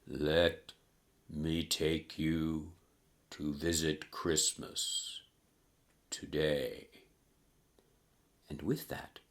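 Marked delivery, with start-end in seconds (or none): 0.1-6.9 low, soft, very slow spirit voice; solemn ghost. Acted. Ghost offers Scrooge a visit, slow and solemn. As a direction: invite slowly, with spaces between the phrases. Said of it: "these words it spoke".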